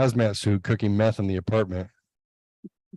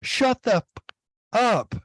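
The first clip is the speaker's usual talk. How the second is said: put-on kid yelling; 12 semitones higher than usual. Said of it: "Hunter got up on the table and yelled".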